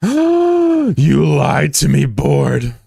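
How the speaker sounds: gasp, then slow, loud, hammed-up accusation; drawn-out melodramatic outrage; mock betrayal. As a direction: accuse it with theatrical hurt. Mock betrayal by the game board.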